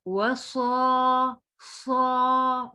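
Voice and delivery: सौ with a thick, heavy sad; heavy saad. Shown as a demonstration. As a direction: say सौ full-mouthed and heavy. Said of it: "मोटा पढ़ेंगे इसको".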